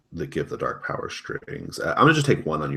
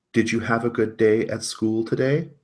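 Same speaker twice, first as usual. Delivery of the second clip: sweet mom voice; 6 semitones higher, 4 dB louder than usual. Acted. GM voices mother character.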